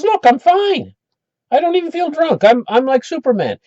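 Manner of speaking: high-pitched, invincible little show-off; boastful kid. Retold or imitated. Jimmy tells watching kids he is immune, high boastful kid voice. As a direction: crow like a boy proving he's tough.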